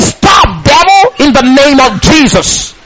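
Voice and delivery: commanding cry at the devil; forceful rebuke. Shown as a demonstration. Believer casts out troubling thoughts, commanding. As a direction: rebuke it with full authority, drawing out the words.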